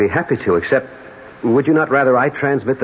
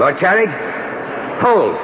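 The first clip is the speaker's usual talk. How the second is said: raised, high-pitched halt; commanding challenge; 6 semitones higher than usual. Acted. Lover halts armed husband, raised challenge. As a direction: call out to stop him in his tracks.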